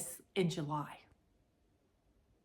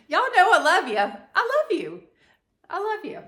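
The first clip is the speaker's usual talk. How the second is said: affectionate; 10 semitones higher, 7 dB louder than usual. Real, not acted. Making up with viewers after rant.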